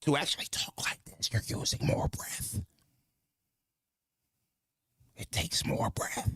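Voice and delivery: strong, breath-heavy whisper. Shown as a demonstration. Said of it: "when you're whispering".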